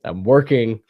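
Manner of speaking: sick with a stuffy nose; talking through a cold, sniffling. Real, not acted. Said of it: "As you can hear, my awesome snorting, and sniffling".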